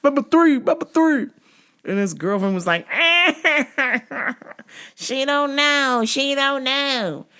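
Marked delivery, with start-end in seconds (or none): 0.0-1.3 high, loud imitation of customer; high mocking voice. Imitating the guy again. 5.0-7.3 put-on high, slow girlfriend voice; high slow drawl.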